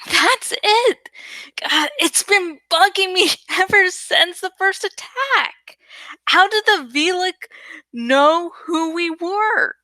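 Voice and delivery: high, eager rush of realization; a touch of breathless excitement; excited. A narrator's reading. Cassie explains her realization to friends, excited and high.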